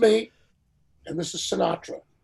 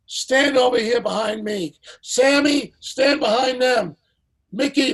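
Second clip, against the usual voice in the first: calling out names, higher and louder; 7 semitones higher, 6 dB louder than usual. Retold or imitated. [name] lining up stars on the dais, a loud commanding call. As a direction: summon the stars like the host in charge. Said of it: "So he says".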